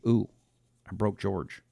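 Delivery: normal talking voice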